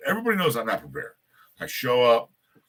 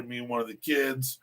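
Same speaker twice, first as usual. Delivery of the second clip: ordinary delivery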